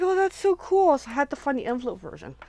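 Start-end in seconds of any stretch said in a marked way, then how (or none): 0.0-1.0 excited gush. Retold or imitated. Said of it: "And I'm like".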